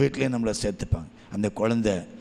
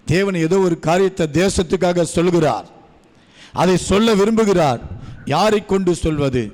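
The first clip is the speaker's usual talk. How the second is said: higher, louder preaching voice; 5 semitones higher, 5 dB louder than usual. Acted. Preacher to the congregation, rising loud preaching. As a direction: build the sermon up with force. Sermon at full force.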